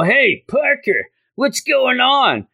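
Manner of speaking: lightly acted, drawn-out greeting; schoolmate calling out. Classmate calling to Peter as he walks by, lightly acted hail. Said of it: "you know, that Peter Parker guy?".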